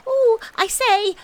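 very high, loud squeal; drawn-out high-pitched exclamation; excited Piglet. A narrator's reading. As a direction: squeal it in a tiny flustered voice. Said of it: "jumping up and down and making".

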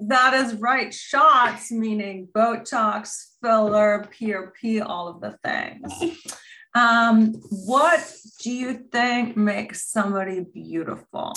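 high, sing-song mommy voice; bright and sweet, as if to a toddler; talking to a child. Acted. Mom introduces her daughter to the podcast, high sing-song.

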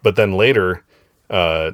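normal talking voice

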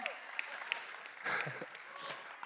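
breathy voiceless laugh; long amused laugh. Real, not acted. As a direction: laugh at your own singing. Laughs at own singing.

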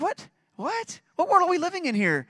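very high-pitched, rising disbelief; incredulous. Acted. Preacher reacts to the unforgiving servant, incredulous. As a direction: squeak out the baffled questions.

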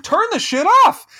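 high, loud, fed-up outburst; exasperated yell. Retold or imitated. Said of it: "I was like".

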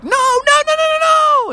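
very high repeated no; tiny frog panic. Acted. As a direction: squeak it as a foot comes down. Frog almost stepped on.

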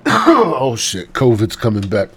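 mock alarm. Real, not acted. Joking to the listener after a coughing fit, loud mock panic.

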